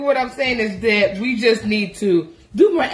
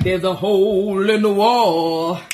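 slow, lower, deliberate deadpan; 6 semitones lower, slower than usual. Retold or imitated. Telling Hezekiah about the hole, slow and deadpan. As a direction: spell it out slowly like it's obvious. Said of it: "And I'm like".

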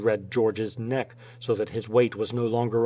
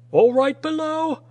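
high, loud holler; calling down; 6 dB louder than usual. A narrator's reading. Checking on the mates below, long-distance call.

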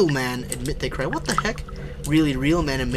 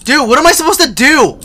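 high, loud, helpless plea; raised, rattled voice; panicked; 9 semitones higher, 7 dB louder than usual. Real, not acted. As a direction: cry out for help in a panic. Panicking at a monster in game.

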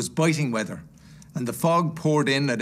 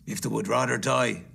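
lowered, unmoved reply; callous miser, pitched down; cold Scrooge; 3 semitones lower than usual. Acted. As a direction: throw the plea back coldly. Scrooge coldly answers gentleman.

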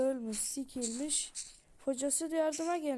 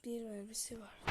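whisper; 8 dB quieter than usual. Real, not acted. Quiet aside while playing.

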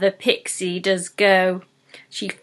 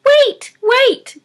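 high, loud squeaky pixie cry; squeaking pixie; 6 dB louder than usual. Acted. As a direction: squeak it out in panic. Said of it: "T P squeaks".

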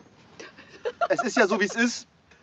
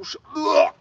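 disgusted cry; 9 semitones higher than usual. Real, not acted. Disgusted by fish insides.

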